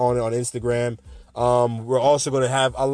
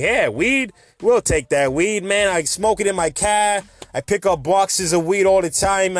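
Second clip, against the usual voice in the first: higher, hammed-up Boston accent; bad Boston accent; 5 semitones higher, 3 dB louder, slower than usual. New Englanders welcoming weed, bad Boston accent. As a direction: play a weed-happy Bostonian with a terrible accent. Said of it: "that's the worst Boston accent you probably heard".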